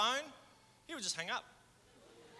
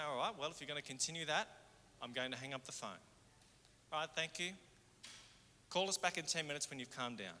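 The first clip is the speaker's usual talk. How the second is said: kindly; 5 semitones lower, 6 dB quieter than usual. Shown as a demonstration. Call centre worker calmly warning an abusive caller. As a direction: lower and softer, calm and polite. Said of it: "you just kindly say".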